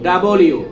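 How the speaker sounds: "ডব্লিউ" as loud da-bol-yoo; correct double-U. Shown as a demonstration. Teacher showing the right W, loud "ডব্লিউ" as da-bol-yoo. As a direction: open out "ডব্লিউ" into double plus U. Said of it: "দুইটা ইউ".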